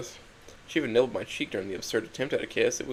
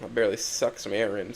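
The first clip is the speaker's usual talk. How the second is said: usual speaking voice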